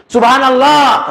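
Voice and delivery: high, loud, slow drawn-out Subhanallah; congregant correcting imam. Retold or imitated. Congregant correcting the imam's prayer count, loud and drawn out. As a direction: stretch it out to warn the imam. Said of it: "kata makmumnya".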